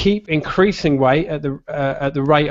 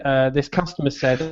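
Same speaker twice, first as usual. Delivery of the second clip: usual speaking voice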